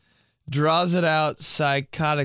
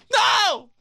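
high, loud scream of refusal; anguished high yell; 5 dB louder than usual. Real, not acted. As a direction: shriek in horror. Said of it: "me screaming the whole time".

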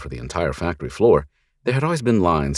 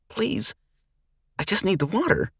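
put-on pleading girl. Frail girl begging the men for water, timid plea. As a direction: beg timidly as a scared young girl.

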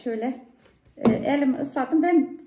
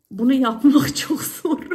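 laughing; 6 dB louder than usual. Real, not acted. Laughing about how hard.

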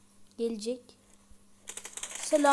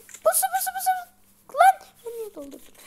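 laughing panic; 14 semitones higher, faster than usual. Real, not acted. Ambush panic while reloading.

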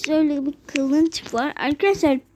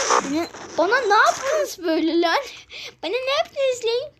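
rising, higher, indignant "what did you do"; mock outrage; 9 semitones higher than usual. Real, not acted. Gamer yelling at other players who transformed him, mock outrage. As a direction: demand an answer, half joking. Turned into something in game.

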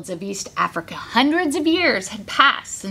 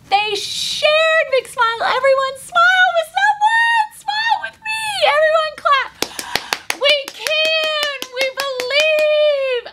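joyful cheer. Real, not acted. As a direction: shout it with joy. Big joyful finale.

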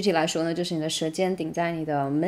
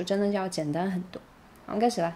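ordinary talk

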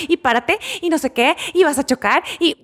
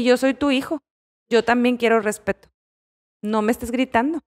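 ordinary talk, nothing marked

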